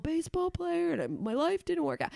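oversharing guest. Retold or imitated. Podcast host mimics wine-loosened guests pouring it out, mock confessional. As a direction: imitate a guest pouring their heart out, voice up high. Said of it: "pouring their shit out here. And they're like".

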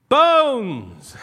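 sudden loud cry of bones; one loud exclaimed word; shouted. Acted.

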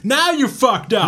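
very high, slow, loud crab holler; shouting mudcrab. Acted. Imitating top-hatted mudcrabs rising from the ground, high drawn-out shout. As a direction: stretch out a mock threat at top pitch. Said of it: "ne rupee huutelemaan".

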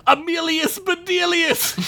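high, drawn-out incantation; shouted spell. A narrator's reading. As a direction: cry out the spell in a high, slow voice. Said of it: "she shouted".

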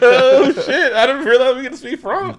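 high-pitched laughing through the words; cracking up mid-sentence. Real, not acted. Laughing at his own French joke, high and giddy.

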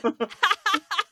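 high-pitched burst of laughter; real laugh. Real, not acted. Amused by the blunt question about swerfs, real laughter. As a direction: crack up at the question.

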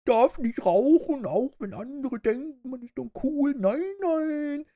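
put-on high, slow kids' TV bear voice; preachy children's host, drawn out; Erklärbär. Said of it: "während der Erklärbär immer versucht".

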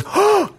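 very high, sharp imitated gasp; shocked gasp. Bully stunned at being spat on, sharp high gasp. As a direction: gasp like you can't believe it. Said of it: "he, like, goes".

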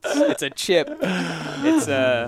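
loud, hammed-up British word list; mock British. Host teases guest's bodega, mock British accent. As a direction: tease in exaggerated British, laughing.

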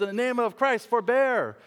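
high, ringing proclamation; retold cry. Preacher voicing Jesus running into the arena, crying out. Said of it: "runs into the arena and says".

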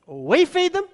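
high, disbelieving repeat of feed; incredulous echo. Retold or imitated. Disciples echoing Jesus, high incredulous "We feed them". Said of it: "a response of sarcasm and skepticism".

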